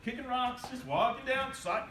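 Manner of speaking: ordinary delivery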